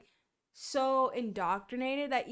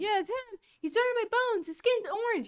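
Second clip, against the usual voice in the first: put-on much higher, excited young woman; lightly voiced girl, pitched up; girl's voice; 9 semitones higher than usual. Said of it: "She's like".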